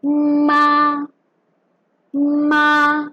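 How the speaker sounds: MA held long on one high, level pitch; sing-level high "ma", no rise or fall; first tone. Shown as a demonstration. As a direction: keep "ma" high and steady. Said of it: "ma with the first tone".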